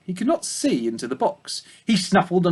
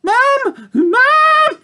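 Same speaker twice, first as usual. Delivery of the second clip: very high, loud frightened piglet cry; terrified shout; 8 dB louder than usual. Acted. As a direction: scream for mother in terror. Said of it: "shouted Babe in terror".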